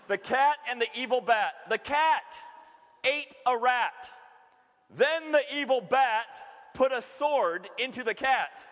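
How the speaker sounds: put-on playful storyteller reading a child's fable; storybook reading. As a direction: read it like a bedtime story for kids. Reads niece's fable aloud.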